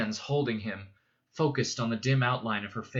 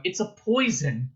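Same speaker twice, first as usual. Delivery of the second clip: higher, louder urgent poison; 8 semitones higher, 4 dB louder than usual. A narrator's reading. Paul warning his mother, urgent outburst. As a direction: press poison hard. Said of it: "His voice lowered".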